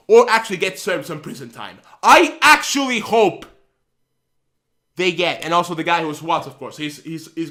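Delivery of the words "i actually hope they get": loud, slow insistence; worked-up wish, almost shouting; heated. Real, not acted. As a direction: push it out slow and loud, really angry. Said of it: "getting so worked up".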